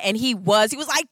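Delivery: ordinary talk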